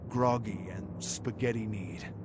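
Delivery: plain reading voice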